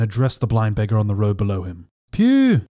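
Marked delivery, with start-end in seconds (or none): 2.1-2.7 high, loud whistle of dismay; cry of surprise. A narrator's reading. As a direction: blow it out, stunned. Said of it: "he cried".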